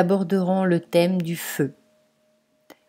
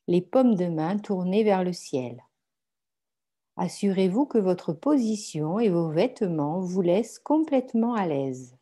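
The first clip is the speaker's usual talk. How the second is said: guided meditation; 4 dB quieter than usual. Acted. Guides a yoga nidra meditation. (méditation guidée, voix douce et posée)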